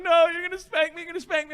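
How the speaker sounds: high imitation of a worried child; scared little son. Painted son afraid of Dad's spanking, scared child voice. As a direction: plead in a small boy's high voice.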